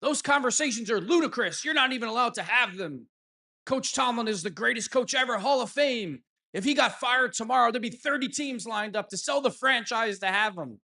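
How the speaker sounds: high, indignant mock scolding; mocking Ian Rappaport. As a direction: parody a pundit scolding the fans. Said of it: "he scolds everyone saying".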